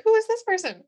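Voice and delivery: high, loud, alarmed demand; mock panic. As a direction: play a bewildered groom crying out. Acted groom confronting the objector.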